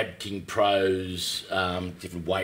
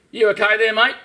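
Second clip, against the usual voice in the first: calling out; 11 semitones higher, 8 dB louder than usual. Retold or imitated. Cop calling from car.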